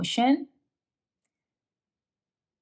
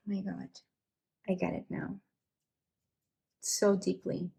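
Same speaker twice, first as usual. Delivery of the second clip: much softer, stunned near-whisper; hushed awe; 7 dB quieter than usual. Real, not acted. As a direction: drop to a stunned hush. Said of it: "I'm kinda, like, quiet".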